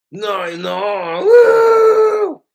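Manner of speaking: cartoon call ending in a very high, loud whoop; drawn-out no, then shrieked whoop; Regular Show voice. Friend answers the lightweight setup, Regular Show whooping voice. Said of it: "You just do a regular show voice".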